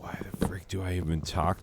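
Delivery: fast breathy-free whispered aside; exasperated whisper. Retold or imitated. Fed up with her own brain, a fast whispered complaint. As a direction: hiss it under your breath, fed up. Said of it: "then you're like".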